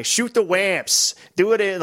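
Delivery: mock the silly voice line; annoying call-out guy. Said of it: "But then you have the guy".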